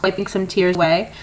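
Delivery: usual speaking voice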